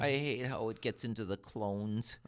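quiet, mock-moody Anakin line; a hint of sulky Anakin, quite soft; quoting Anakin. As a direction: mumble it like a brooding teen Jedi. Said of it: "He said".

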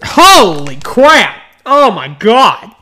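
loud, high, slow outcry; recoiling at a bad smell; surprised. Real, not acted. Opens the bag, smells it.

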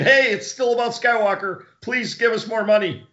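high, pleading mock apology; pleading studio voice. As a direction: beg like a sheepish studio. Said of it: "they're saying".

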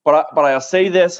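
normal talking voice

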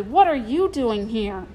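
ordinary delivery, nothing marked

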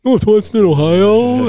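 high, loud, slow, drawn-out answer; sheepish squeak. Retold or imitated. Rookie admitting his small school, high and drawn-out. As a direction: stretch it up high, almost wincing. Embarrassed admission to teammates.

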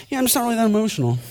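rattled off fast, in a mock voice of the churchgoer; a quick, fake-casual shrug of a line; mock indifferent brush-off. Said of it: "He's like".

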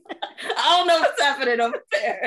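laughing while talking. Real, not acted. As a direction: giggle through the line. Laughing after partner's joke.

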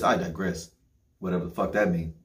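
ordinary talk

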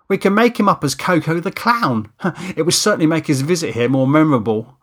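voiced Steve, teasing. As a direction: pitch a daft idea with relish and laugh. Steve teases about clown makeup.

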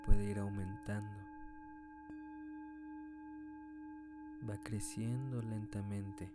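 calm, unhurried meditation guide; guided meditation. Acted. Leads a meditation. (voz de meditación guiada)

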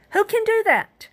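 high, loud rhetorical cry; preached cry. Real, not acted. Preacher challenging the congregation, high and loud. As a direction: throw the question at the congregation.

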